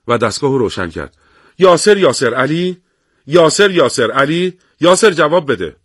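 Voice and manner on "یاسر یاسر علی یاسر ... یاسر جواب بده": calling over radio. Acted. Radio operator calling the battalion.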